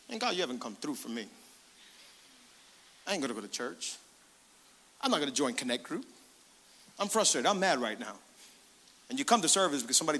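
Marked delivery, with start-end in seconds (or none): 0.1-8.2 sulking complaint. Retold or imitated. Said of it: "sometimes we've gotten frustrated with God".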